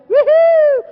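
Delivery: a high, loud cheer; crowd-rousing whoop. Real, not acted. Speaker whoops at the crowd before asking a question, high and loud. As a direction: whoop to wake the audience up.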